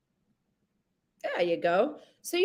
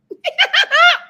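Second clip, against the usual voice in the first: big real laugh, high and loud; shrieking with laughter; 10 dB louder than usual. Real, not acted. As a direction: burst out laughing at the mute. Laughing at muted mic.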